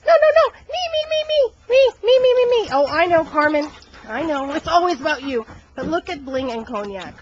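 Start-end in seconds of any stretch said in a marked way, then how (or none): 0.0-5.5 very high, loud squeaky me-me-me; Carmen's voice. Acted. The bratty young bird demands attention, very high and loud. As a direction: chant me in a shrill bratty squeak. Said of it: "and then Carmen's like".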